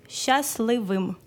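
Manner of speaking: slow, careful syllable by syllable; slow teaching demo. Shown as a demonstration. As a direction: spell it out slowly for a learner. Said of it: "Être, ça veut dire bути".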